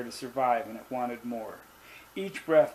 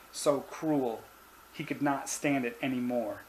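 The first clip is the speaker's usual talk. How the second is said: plain reading voice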